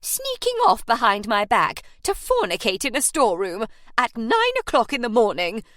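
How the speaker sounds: loud, scandalised matron's accusation; throbbing outrage. Acted. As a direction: boom it out like a shocked landlady. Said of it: "throbbed from the doorway".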